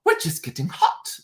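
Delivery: cringey, over-enunciated diva impression. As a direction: mimic a diva trying too hard to sound colloquial. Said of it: "it sounds way over enunciated".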